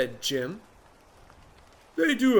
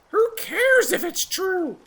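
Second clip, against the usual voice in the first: very high, slow chirpy bird; 5 dB louder than usual. Acted. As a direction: squeak it like a tiny bird. Oxpecker voiced.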